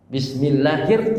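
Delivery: slow Bismillahir, thin then heavy sounds; recitation demo. Shown as a demonstration. Preacher slowly models Bismillahir, thin then heavy letters. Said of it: "tipis dulu masuk, roh tebal".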